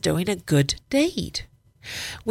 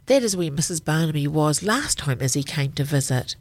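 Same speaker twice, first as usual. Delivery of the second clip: usual speaking voice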